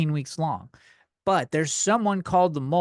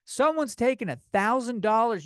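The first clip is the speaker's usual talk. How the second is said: normal talking voice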